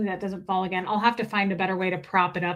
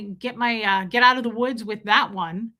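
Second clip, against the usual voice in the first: ordinary talk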